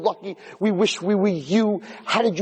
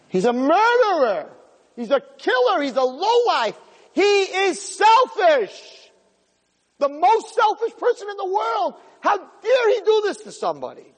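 fiery condemnation; 11 semitones higher than usual. Real, not acted. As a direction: denounce him with full force. Preacher condemns the husband.

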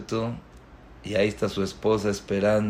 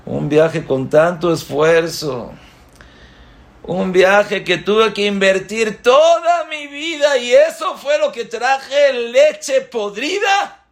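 crying lament; 9 semitones higher, 5 dB louder, slower than usual. Acted. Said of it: "lloraron los dos juntos diciendo".

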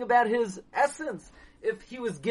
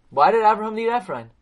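normal talking voice

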